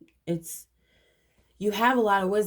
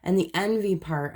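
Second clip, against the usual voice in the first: usual speaking voice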